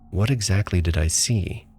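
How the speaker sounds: plain reading voice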